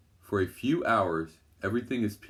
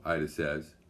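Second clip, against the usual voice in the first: usual speaking voice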